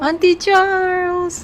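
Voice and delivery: high, loud, slow praise; excited cheer. Real, not acted. Cheering the child's answer.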